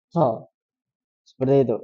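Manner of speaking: ordinary talk, nothing marked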